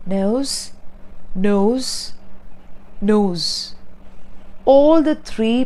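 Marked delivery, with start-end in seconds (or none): 0.1-0.7 British-accented NOSE, rising as in a list; British NOSE with a gliding O; British pronunciation. Shown as a demonstration. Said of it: "This is". 1.3-2.1 American-accented NOSE, rising as in a list; American NOSE with a rounded glide; American pronunciation. Shown as a demonstration. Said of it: "American pronunciation is". 3.0-3.7 Indian-accented NOSE, falling to close; Indian pronunciation. Shown as a demonstration. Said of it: "Indian is".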